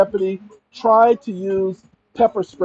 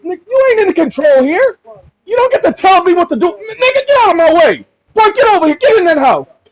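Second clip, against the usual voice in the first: much higher, shouting to the officer; high, loud confrontation; 13 semitones higher, 8 dB louder than usual. Pretend. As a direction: scream him off your property. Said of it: "That's my conversation at that point".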